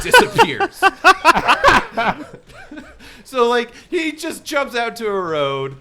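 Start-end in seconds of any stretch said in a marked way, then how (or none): 0.9-3.2 high, loud rising laugh; real burst of laughter. Real, not acted. Laughs at Jeremy vanishing.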